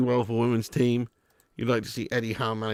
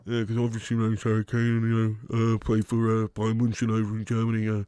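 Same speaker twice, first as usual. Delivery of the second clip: low, nasal footballer voice; Harry Kane; 4 semitones lower than usual. Acted. Footballer introducing himself, low nasal impression. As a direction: talk dully through the nose. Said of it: "I'm not really great at Harry Kane, but I can try".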